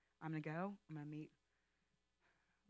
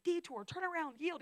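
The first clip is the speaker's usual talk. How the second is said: higher, louder announcing of the signs; mock sign reading; 10 semitones higher, 6 dB louder than usual. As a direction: call out the signs like a joke. Reading road signs from movie.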